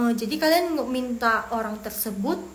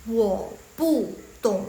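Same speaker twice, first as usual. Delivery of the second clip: very slow, word-by-word Mandarin; slow model reading; 3 dB louder, much slower than usual. Shown as a demonstration. Teacher shows beginners the first phrase, slow model reading. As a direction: space out each syllable for learners. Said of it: "Coba yang pertama".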